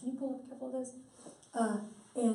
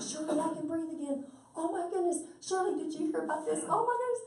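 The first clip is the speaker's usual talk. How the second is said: high, rising excitement, building to a squeal; excited testimony; 7 semitones higher than usual. Retold or imitated. Patient gushing to Shirley about breathing again, excitedly higher. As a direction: voice a thrilled patient telling a friend.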